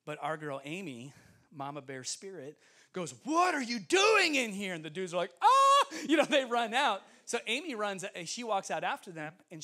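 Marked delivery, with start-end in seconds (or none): 3.2-4.7 high, loud, drawn-out scolding yell; indignant retold shout; mama bear outburst. Protective teammate confronts intruding boys. 5.4-5.9 high, loud yelp of fright; hammed-up panicked shriek; mock startled scream. Imitates boys screaming in fright.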